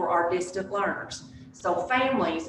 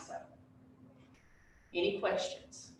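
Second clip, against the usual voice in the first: normal talking voice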